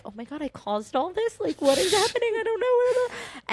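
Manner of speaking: high, flustered acted panic; mock panic. Woman recalling thinking she caused the drama, mock panic. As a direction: replay being overwhelmed, pitch climbing steeply. Overwhelmed bystander amid backstage yelling.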